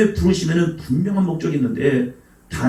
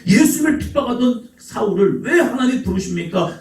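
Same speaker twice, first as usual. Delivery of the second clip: ordinary talk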